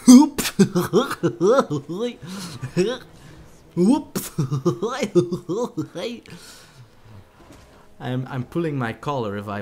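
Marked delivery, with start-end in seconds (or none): 0.0-6.3 loud laughing fit; laughing hard. Real, not acted. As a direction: burst out laughing. Laughing at own death.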